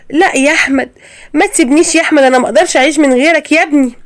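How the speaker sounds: high, begging cry; cling to him with the voice; mother begging her son not to leave her; desperate plea. Acted.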